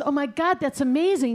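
high, acted thrill; amazed excitement. Husband hearing his wife is cured, amazed excitement. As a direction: exclaim it as joyful news. Husband hears news in retold joke.

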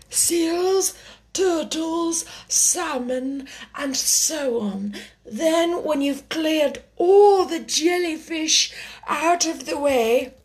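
high-pitched, deliberate creature voice; Mock Turtle explaining. A narrator's reading.

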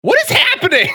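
high shouted disbelief; surprised shout. Real, not acted. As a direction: yelp it out in surprise. Stream breaking, exclaiming.

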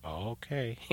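soft, small "oh, okay"; meek retold agreement. Homeowner yielding to the angry electrician, meek and quiet. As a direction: give in meekly, well under your voice.

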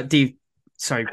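normal talking voice

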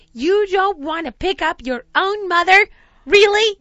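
loud, high, guilt-tripping mom voice; hammed-up offended mother, peaking on the last word; indignant mom. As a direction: imitate a mother appalled at her child. Mom indignant at daughter's suggestion.

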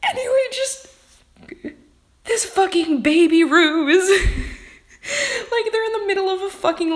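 high, squeaky laughing speech; cracking up. Real, not acted. Reader losing it over the baby ruse, cracking up. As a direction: barely get the words out through real laughter.

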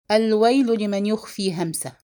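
ordinary delivery, nothing marked

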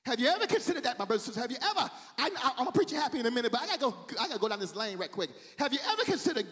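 ordinary talk

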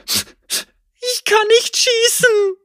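high, slow terrified wail; a frightened girl cries out, slowed by panic; scared girl wailing to Lucian. A narrator's reading. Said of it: "heulte sie auf".

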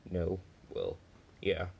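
ordinary delivery

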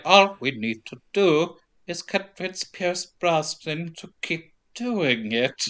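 slow, soft mid-Atlantic whisper; Hank's drawn-out whisper. Retold or imitated. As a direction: draw out every word in a hushed, odd whisper. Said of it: "drawing out every word / doing it in a weird whisper".

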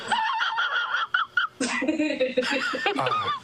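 high, squealing burst of laughter; real laughter. Real, not acted. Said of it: "No, they look laughing".

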